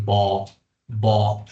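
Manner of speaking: "bo" between O and A, lips barely moving; glottal "bo" demo. Shown as a demonstration. Teacher shows students the glottal "bo" sound. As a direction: say "bo" from the throat, mouth still. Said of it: "Es un sonido glotal".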